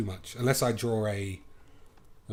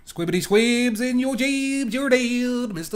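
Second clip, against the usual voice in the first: silly taunt voice; 14 semitones higher, 9 dB louder than usual. Pretend. Silly taunting at flying squid.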